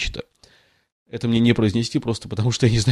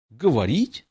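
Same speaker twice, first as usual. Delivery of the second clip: a light indignant mouse squeak; the trembling mouse rebuffs Alice indignantly; 5 dB quieter, slower than usual. A narrator's reading. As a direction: squeak it out, outraged. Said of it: "с негодованием пискнула мышь".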